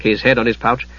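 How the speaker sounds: usual speaking voice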